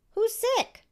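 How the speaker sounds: put-on high, bright little girl question; a small girl puzzled by the word doctor; innocent child. A narrator's reading. Young Connie asking Daddy who is sick, high and innocent.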